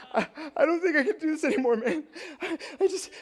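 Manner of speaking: high, fast, laughing mock panic; hammed-up frightened wail; scared whimper. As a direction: imitate a terrified friend clinging on. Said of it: "I was like".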